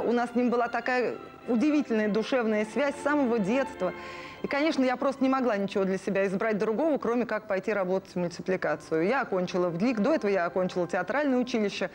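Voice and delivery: normal talking voice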